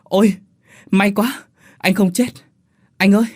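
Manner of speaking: high, loud cries of relief; acted elated shout to a brother; joyful exclamation. A narrator's reading. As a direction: cheer out in overjoyed relief. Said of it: "Thằng Tế reo lên".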